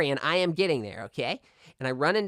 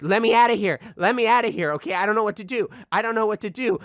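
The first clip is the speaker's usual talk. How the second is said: higher, louder panicked pleading; panicked plea; 5 semitones higher, 3 dB louder than usual. Retold or imitated. Boy trapped in freezer, begging in panic. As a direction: beg to be let out in a panic. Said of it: "I'm like".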